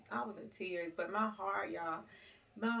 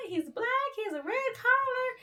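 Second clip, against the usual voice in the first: much higher, louder excited voice; excited caller; 6 dB louder than usual. Retold or imitated. Woman on the phone describing the found dog, high and excited. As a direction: squeal it out like the eager caller. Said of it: "She was like".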